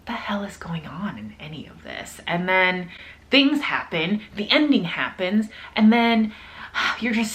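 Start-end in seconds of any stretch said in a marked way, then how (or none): 0.1-2.2 soft, bewildered whisper; baffled whisper. Retold or imitated. Imitating a reader before the climax, a bewildered whisper. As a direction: breathe it out, utterly lost. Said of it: "where you're like".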